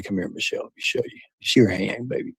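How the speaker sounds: hushed seductive whisper to Michelle; whispered come-on. Retold or imitated. Streamer shows Bob how to whisper to Michelle, hushed. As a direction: breathe it low like a smooth talker. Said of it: "You gotta say".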